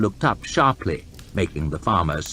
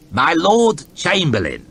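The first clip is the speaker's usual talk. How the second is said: pompous intoning; 9 semitones higher, 5 dB louder than usual. Acted. Guild master greeting the lord chamberlain with an elaborate bow. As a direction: greet him with oily ceremony. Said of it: "intoned Colt with an elaborate bow".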